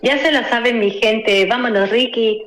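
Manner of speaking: mimicking a Mexico City catchphrase, lightly; Mexico City accent. Shown as a demonstration. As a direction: acento chilango imitado. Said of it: "a ver si puedes repetir".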